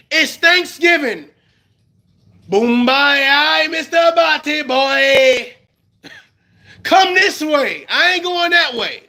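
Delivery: mock Thanksgiving visit; hammed-up holiday scene. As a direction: play the relative who won't go back.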